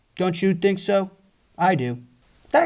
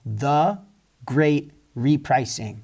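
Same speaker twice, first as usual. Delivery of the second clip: slow emphatic slogan; much slower than usual. Real, not acted. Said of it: "slow emphatic slogan".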